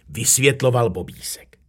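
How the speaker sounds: usual speaking voice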